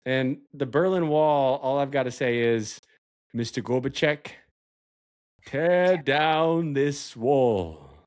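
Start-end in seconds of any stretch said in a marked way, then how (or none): 3.3-7.9 very slow, drawn-out oratory; mock speechmaking. Quoting the Berlin Wall line to co-host, mock oratory. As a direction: stretch each word like a grand proclamation. Quotes a famous political speech.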